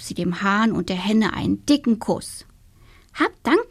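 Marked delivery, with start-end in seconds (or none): 3.1-3.7 high little witch voice, thankful; the cheerful little witch giving thanks; grateful little witch. Acted. (dankbare kleine Hexe, hohe Stimme)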